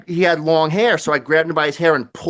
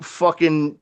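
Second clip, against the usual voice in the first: normal talking voice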